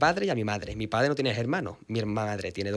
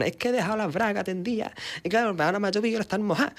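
higher, fast Andalusian chatter about the laundry; Andalusian gossip; 8 semitones higher, faster than usual. Retold or imitated. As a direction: rattle it off like an Andalusian neighbour. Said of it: "yo le escuchaba cuando hablaban".

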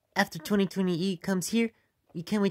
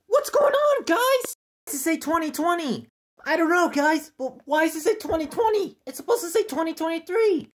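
high, louder panicked questions; panicked confusion; 8 semitones higher, 4 dB louder than usual. Acted. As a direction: panic at the screen. Panicking about the wrong year.

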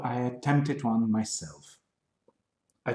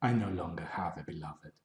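whispered line; 7 semitones lower, 9 dB quieter, much faster than usual. Acted. Poem line, voice drops away.

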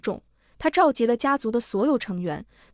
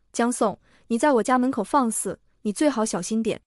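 ordinary delivery

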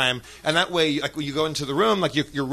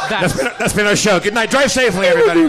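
loud, rising closing announcement; mock sign-off; 3 semitones higher, 7 dB louder than usual. Comic pretending the show is over, loud mock sign-off. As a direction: call a joke farewell to the crowd.

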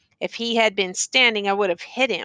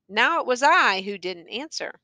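ordinary delivery, nothing marked

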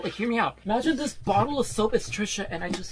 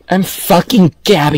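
very loud, low-pitched roar; furious shout; 4 semitones lower, 15 dB louder than usual. Acted. Guest crowding the interviewer who asks for space, furious shouting. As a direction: roar your own name in rage. Interviewer begs her to back up.

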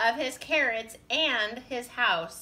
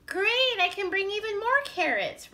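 high, eager rabbit voice; smiling delight; 11 semitones higher than usual. A narrator's reading. Rabbit accepting Beaver's offer with more carrots, high and delighted. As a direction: pipe up gleefully with a smile. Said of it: "Rabbit said with a smile".

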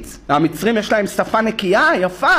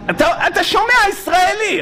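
excited; 9 semitones higher than usual. Real, not acted.

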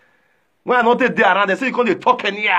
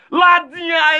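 loud, higher call of the name; shouted name; 9 semitones higher, 4 dB louder than usual. Retold or imitated. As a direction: belt the name out. Summoning call in retold scene.

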